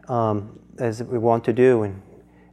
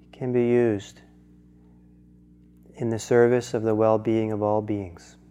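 usual speaking voice

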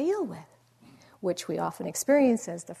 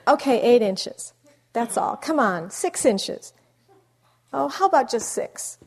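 bargaining; 4 semitones higher, 9 dB louder than usual. Retold or imitated. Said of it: "that little bargaining there".

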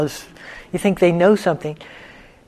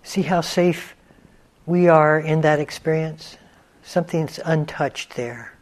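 unhurried meditation guidance with long pauses; guided meditation; 3 dB quieter than usual. Real, not acted. Teacher leading students into stillness, guided meditation voice. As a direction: guide them gently into stillness, leaving space between phrases.

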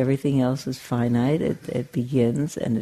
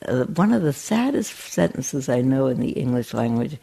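ordinary talk, nothing marked

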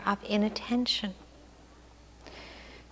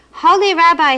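crying out; 6 semitones higher, 8 dB louder than usual. A narrator's reading. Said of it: "he cried".

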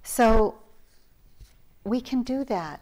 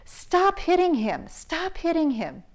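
mock yell, much higher, a bit louder; imitating a shout, pitched up; retold yell; 9 semitones higher, 4 dB louder than usual. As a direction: order the attacker to stop. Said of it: "as loud as I could".

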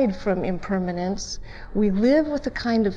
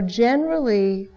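normal talking voice

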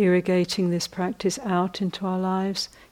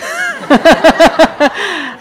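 loud laugh; 10 semitones higher, 15 dB louder than usual. Real, not acted. Laughing mid-joke.

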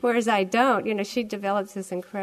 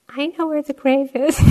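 put-on higher and louder, like a kid blurting it out; five-year-old blurting; 6 semitones higher, 8 dB louder than usual. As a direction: regress into a small child telling Dad. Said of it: "I turn into this five-year-old".

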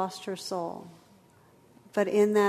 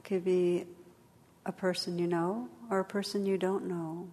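guided meditation; 3 dB quieter than usual. Acted. Leads a meditation.

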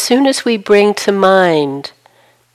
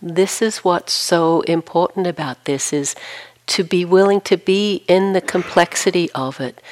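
ordinary talk

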